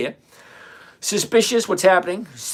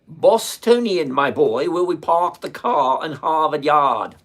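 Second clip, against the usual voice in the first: put-on Boston accent, dropped r's, a bit louder; 2 semitones higher, 6 dB louder, slower than usual. Boston accent for the viewer asking about his accent. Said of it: "is that a northern accent?".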